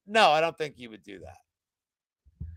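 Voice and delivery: ordinary talk, nothing marked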